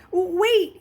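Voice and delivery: loud, high panicked call; boy calling after his departing teacher, panicked; cried out. A narrator's reading. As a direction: call him back in panic. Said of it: "he cried".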